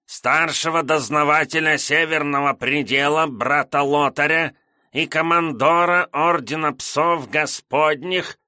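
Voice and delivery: high nasal, nagging clerk reading. A narrator's reading. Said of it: "противным гнусавым голосом".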